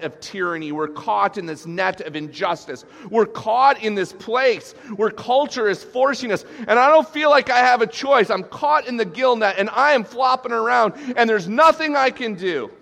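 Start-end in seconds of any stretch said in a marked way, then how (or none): 3.1-12.7 anguished lament. Acted. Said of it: "That's what he's saying".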